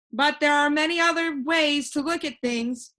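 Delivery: put-on a cheerful know-it-all boy floating in the air; voiced boy Alec.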